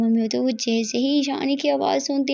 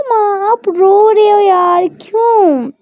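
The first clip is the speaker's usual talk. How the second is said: higher, louder, slow pleading; tender daughter; 8 semitones higher, 7 dB louder, much slower than usual. Acted. Daughter on video call asking her tearful mother why she cries. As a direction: daughter gently scolding her crying mother.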